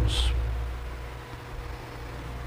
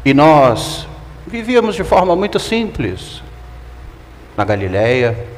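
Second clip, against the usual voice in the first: ordinary talk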